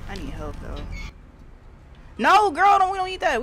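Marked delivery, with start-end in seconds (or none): 2.2-3.4 high-pitched alarmed plea; yelling in a high panic at the screen; panicked. Real, not acted. Panicked yelling at game character.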